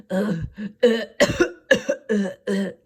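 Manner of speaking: loud retching ughs and a cough; sick coughing groans. Retold or imitated. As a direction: imitate a sick man hacking loudly. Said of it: "He was like".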